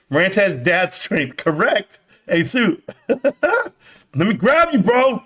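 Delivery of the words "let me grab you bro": laughing. Pretend. Laughing, mock grab.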